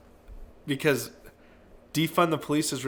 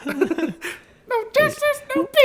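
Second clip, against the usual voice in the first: high, squeaky squirrel chatter; 3 dB louder, much slower than usual. Retold or imitated. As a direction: chant it as a tiny squirrel. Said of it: "that was so funny with the squirrels".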